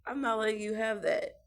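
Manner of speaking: loud, stern, no arguing; firm refusal. Retold or imitated. Mom telling her kid she won't give in, loud and firm. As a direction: lay down the law.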